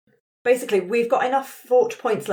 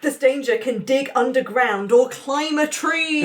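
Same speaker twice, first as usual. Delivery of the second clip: higher, bigger, half-shouted monster reveal; dramatic reveal; 6 semitones higher than usual. Acted. Game master reveals the beast to the players, half-shouted drama. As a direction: sell the danger to the table.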